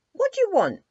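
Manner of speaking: very high, loud startled question; a girl woken by a soldier, alarmed. A narrator's reading. Dimples woken at night by the soldier, startled high question. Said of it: "she asked".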